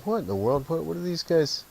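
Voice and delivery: mock-incredulous questioning with pitch lifted; puzzled, retold. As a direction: re-enact bewilderment, starting high and easing down. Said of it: "I was kinda puzzled, thinking".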